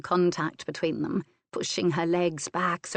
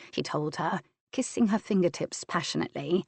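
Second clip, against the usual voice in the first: ordinary delivery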